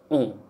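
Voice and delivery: "O." said through the nose; nasal vowel. Shown as a demonstration. Teacher models French nasal vowels, nasalised "O.". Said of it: "We have to pronounce with your nose".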